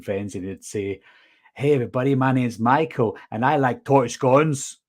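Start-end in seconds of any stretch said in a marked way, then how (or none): 1.5-3.8 imitated American accent, chatty introduction; mock American greeting to friends. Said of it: "You, you go from American to Scottish". 3.8-4.8 sudden switch into Scottish for "torty scones"; slow, emphatic Scottish accent. Retold or imitated. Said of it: "from American to Scottish just like that".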